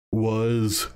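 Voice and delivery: yawning through the words. Real, not acted. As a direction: talk mid-yawn, stretched and airy. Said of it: "I yawn".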